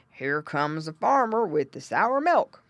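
a hint of slow, loud pig grunt. A narrator's reading. Father pig sees the farmer coming, lightly grunted. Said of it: "grunted Mister Pig".

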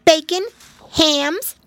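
high, bright little voice; cheerful. Acted. Pigwig tells Pigling cheerfully. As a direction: chirp it cheerfully. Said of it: "replied Pigwig cheerfully".

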